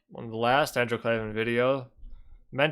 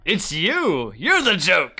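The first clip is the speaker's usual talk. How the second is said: playful teasing jab, a bit louder; mocking taunt; 4 semitones higher, 4 dB louder, slower than usual. Real, not acted. Reactor teasing the speaker on screen, playful mock taunt. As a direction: point and tease.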